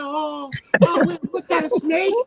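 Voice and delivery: high, happy cartoon quail turning puzzled. Shown as a demonstration. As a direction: squeak like an innocent little quail. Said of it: "do your quail voice".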